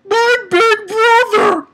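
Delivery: high, loud, drawn-out mock wail; hammed-up anguished cry, high and loud; mocking whine. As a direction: wail it slowly like an overdramatic character. Mocking the character's brother motive.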